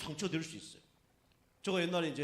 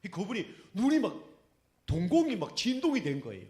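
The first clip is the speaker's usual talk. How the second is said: excited, louder telling; 4 semitones higher, 8 dB louder than usual. Real, not acted. Dramatic climax of the story.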